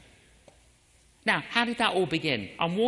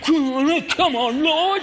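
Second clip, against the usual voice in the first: urgent pleading, pushed louder; pressing prayer, louder and intense; fervent inner prayer; 8 semitones higher, 8 dB louder than usual. Retold or imitated. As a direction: plead with the Lord in earnest. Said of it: "On the inside".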